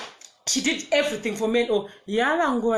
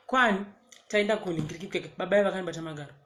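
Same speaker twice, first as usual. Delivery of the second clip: ordinary delivery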